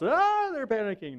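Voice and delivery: high, panicked mock cry. Preacher mimics an unbeliever's panic, high and frantic. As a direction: imitate someone panicking in a storm, high-pitched.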